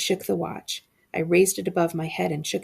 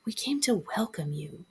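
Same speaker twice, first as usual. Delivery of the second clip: softer, rasping ghostly whisper; hoarse whisper; 4 dB quieter than usual. A narrator's reading. Shadow boy welcomes him in a hoarse whisper. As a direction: rasp it out barely aloud. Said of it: "a boy said in a hoarse whisper".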